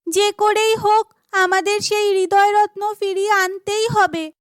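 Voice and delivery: put-on high, firm girlish resolve; determined heroine. Chandrika resolves to recover the gem, high and determined.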